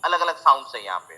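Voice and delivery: normal talking voice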